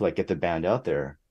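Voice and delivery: usual speaking voice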